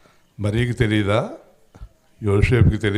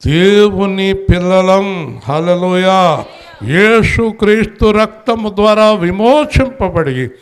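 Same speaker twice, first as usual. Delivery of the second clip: higher, louder, slow and drawn out; fiery preaching; 7 semitones higher, 7 dB louder, slower than usual. Acted. Preacher declaring to congregation who they are, fiery slow proclamation. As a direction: thunder it to the congregation.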